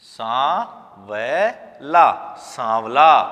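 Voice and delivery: वे and ला stretched one by one; slow syllables. Shown as a demonstration. Teacher breaks the word into syllables. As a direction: draw out each syllable.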